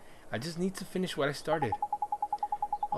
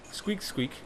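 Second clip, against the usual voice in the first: mouse squeak; 7 semitones higher than usual. Retold or imitated. Imitating mouse.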